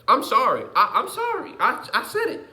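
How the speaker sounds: blurting a laughing sorry; high excited apology. Real, not acted. Apologizing after blunt remark.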